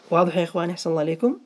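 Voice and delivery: ordinary talk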